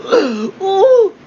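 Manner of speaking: sudden high-pitched ooh of delight; high excited squeal. Acted. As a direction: squeal it out, thrilled. Showing off his air fryer.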